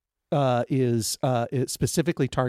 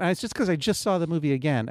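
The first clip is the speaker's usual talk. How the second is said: ordinary delivery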